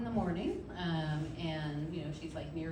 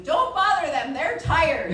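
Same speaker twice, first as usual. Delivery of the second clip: laughing wife voice; 9 semitones higher, 13 dB louder, slower than usual. Retold or imitated. Labouring wife in background.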